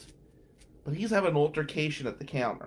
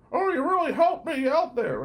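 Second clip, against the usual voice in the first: high, louder gushing mock voice; whiny grateful voice; 14 semitones higher, 5 dB louder than usual. Clingy classmate thanking the narrator, high gushing mock voice. Imitating the annoying guy.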